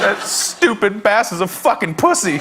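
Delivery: mock tearful outburst. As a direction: imitate a crying kid cursing. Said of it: "continues to cry".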